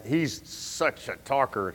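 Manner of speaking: normal talking voice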